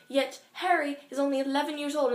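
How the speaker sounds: ordinary talk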